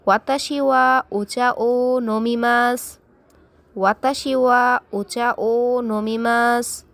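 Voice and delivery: slow, careful Japanese with each word spaced out; teacher drilling "নমিমাস" slowly; slow model repetition. Shown as a demonstration. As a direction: spell it out slowly for learners to echo. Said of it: "বারে বারে বলুন আমার সাথে".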